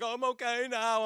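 high-pitched kid imitation; upset child claiming okay. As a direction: squeak it out like a tearful child. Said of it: "And then he's like".

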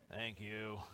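put-on low, soft, unenthusiastic child reply; dutiful thanks. Kid prompted to thank for socks, unenthusiastic. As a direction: mumble a grudging thank-you.

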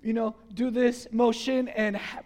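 ordinary talk, nothing marked

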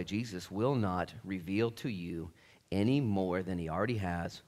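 ordinary talk, nothing marked

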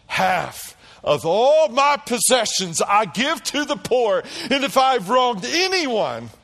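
high, loud, emphatic public vow; slow-building declaration at full voice; loud proclamation. Acted. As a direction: stand tall and declare it to all. Acts Zacchaeus's public declaration.

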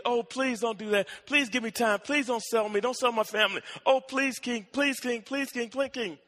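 high, urgent begging as the servant; acted desperate plea; pleading. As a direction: plead rapidly, piling up the pleases. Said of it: "And the guy pleaded".

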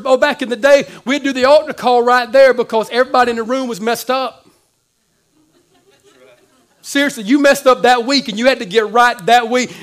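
loud, driving preacher voice; full-force pulpit delivery; preaching at full force. Acted. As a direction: preach it out to the room.

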